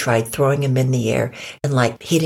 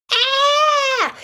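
very high sustained shriek; acted terrified scream; 4 dB louder than usual. People flee the coyote, acted panicked scream. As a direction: let out one long piercing scream.

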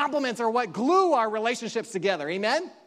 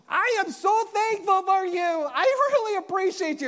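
sincere thanks; 8 semitones higher, 4 dB louder than usual. Retold or imitated. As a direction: thank her from the heart, gently lifted. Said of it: "I meant it so sincerely".

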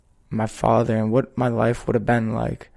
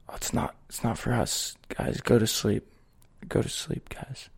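half-whispered, soft and hushed, settling the little ones; hushed reassurance; 9 dB quieter than usual. Retold or imitated. Older brother hushing his siblings at night, soft half-whisper.

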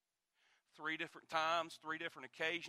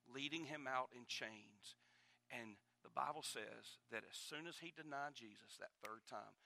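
ordinary talk, nothing marked

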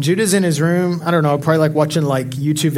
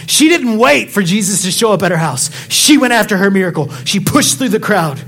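loud, high-pitched sermon delivery; impassioned pulpit exhortation; preaching at full force; 6 semitones higher, 4 dB louder than usual. Real, not acted. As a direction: preach it hard to the congregation, punching key words.

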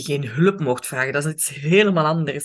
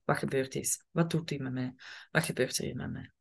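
quiet self-questioning; 3 semitones lower, 9 dB quieter than usual. Shown as a demonstration. Inner self-inquiry.

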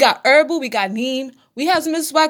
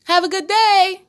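mock cheerful sendoff; 8 semitones higher than usual. Mocking parent sending poisoned kid.

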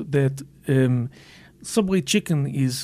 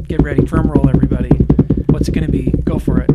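much louder, hyped announcer call; announcer hype; 13 dB louder, faster than usual. Acted. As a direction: build hype for the big reveal. Announcing a drum roll.

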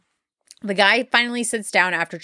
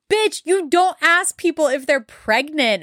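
higher-pitched, slow, emphatic scolding; indignant retort; 7 semitones higher than usual. Retold or imitated. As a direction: act out an indignant telling-off, drawing the words out. Indignant retort to rude question.

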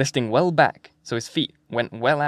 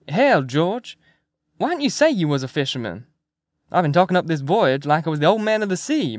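McMurphy, hearty persuasion; 3 semitones higher than usual. Acted. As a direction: win the old man over with breezy patter.

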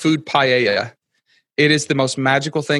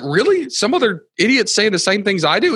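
high-pitched incredulous question; mock disbelief; 6 semitones higher than usual. Guest retelling his reply to the Australian, incredulous. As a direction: act out being indignantly surprised.

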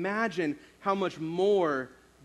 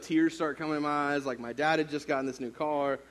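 ordinary delivery

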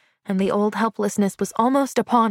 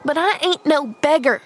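put-on higher, spirited boyish protest; sudden spirit; 6 semitones higher, 3 dB louder than usual. A narrator's reading. Boy insists to Pollyanna on his pride, spirited. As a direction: flare up with wounded pride. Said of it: "with sudden spirit".

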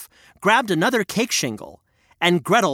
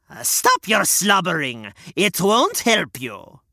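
scolding witch. Acted. Witch scolds Gretel, harsh hag. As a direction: snap at the crying girl.

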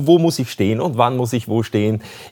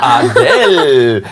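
laughing; 7 dB louder, much slower than usual. Real, not acted. Host laughs at cork singing.

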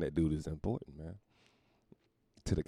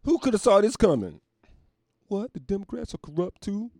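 high-pitched fake astonishment; mock shock; 9 dB louder than usual. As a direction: feign disbelief in a high voice. Mock surprise at political corruption.

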